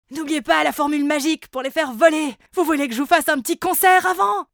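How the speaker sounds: high, loud eager voice; pushy offer to sing; eager creature. A narrator's reading. Chichi offers concert. (créature enthousiaste et fort)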